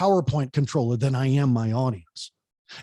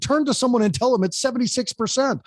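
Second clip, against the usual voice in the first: much higher, lively command to the room; much higher, bright stage command; energetic instruction; 8 semitones higher, faster than usual. Shown as a demonstration. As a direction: fire up the audience. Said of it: "I said".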